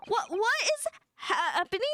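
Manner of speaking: high, slowed, bewildered question; lost and startled at once; confused surprise. Real, not acted. Streamer baffled by a game reveal, high confused.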